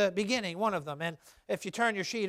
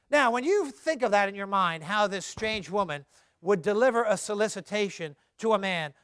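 normal talking voice

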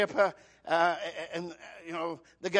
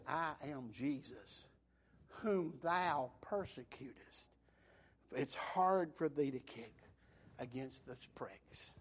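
voice of Jesus; 6 semitones lower, 10 dB quieter, slower than usual. Acted. The Lord answers Saul.

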